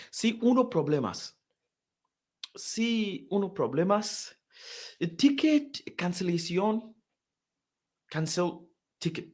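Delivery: high, halting broken Spanish; hesitant pleading. Retold or imitated. Tourist begs girl on counter for help, hesitant pleading.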